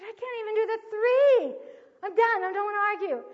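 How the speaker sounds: imitating a daughter at high, loud pitch; desperate protest. Daughter giving up arguing with parent, loud high desperate imitation. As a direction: plead loudly to be let off.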